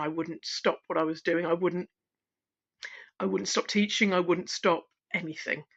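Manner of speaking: ordinary talk, nothing marked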